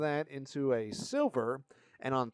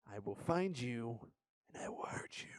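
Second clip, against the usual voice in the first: mock menacing whisper; 4 semitones lower, 10 dB quieter than usual. Mock threat to Bandai.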